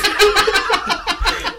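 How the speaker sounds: high, loud burst of laughter; howling laugh. Real, not acted. Friends told the bin story, howling laughter. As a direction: let the laugh explode.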